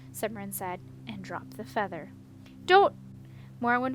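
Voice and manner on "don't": loud high cry of warning; urgent shout. A narrator's reading. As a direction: stop her before it's too late. Morwen trying to stop her.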